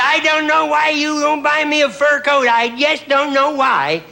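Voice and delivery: mock complaining wife, slowed down; nagging wife. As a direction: whine at the husband for a fur coat. Wife nagging husband for fur.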